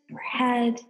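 usual speaking voice

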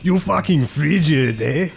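high, hammed-up Mexican accent; cartoonish Mexican accent pitched high; mock Mexican accent. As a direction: ham up a jokey Mexican Jesus accent. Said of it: "the impersonation's hurting my throat".

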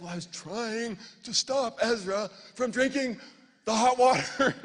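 high, loud protest; exasperated self-defense. Retold or imitated. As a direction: defend yourself, building to a shout. Husband defending his yell.

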